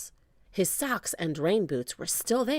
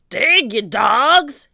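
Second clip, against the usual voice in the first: slow, loud, raised pirate bellow; shouted order; 5 semitones higher, 11 dB louder, much slower than usual. A narrator's reading. Captain driving his men to dig, shouting. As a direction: draw out the shouted command. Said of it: "he shouted".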